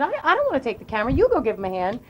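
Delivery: ordinary delivery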